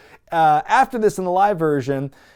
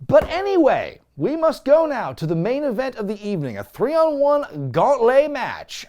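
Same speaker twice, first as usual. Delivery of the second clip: mock announcer; 7 semitones higher than usual.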